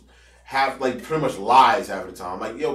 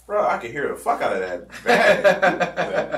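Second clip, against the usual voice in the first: laughing; 4 semitones higher, 3 dB louder, slower than usual. Real, not acted. Laughing at coworker's blunder.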